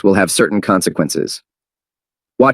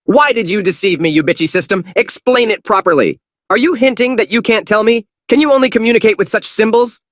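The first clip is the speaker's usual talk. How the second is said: high furious yelling; high angry shouting; 13 semitones higher than usual. Acted. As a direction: rage at the system. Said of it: "shouted in anger".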